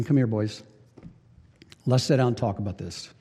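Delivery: quietly gather them round; gentle call to the boys. Retold or imitated. Jesus softly calling his disciples, gentle.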